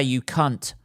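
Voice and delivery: ordinary talk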